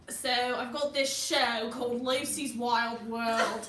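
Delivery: put-on Australian wildlife-show host accent; Aussie accent. Host introduces her wildlife show, Australian accent. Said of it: "Mom really wants to hear your Aussie accent".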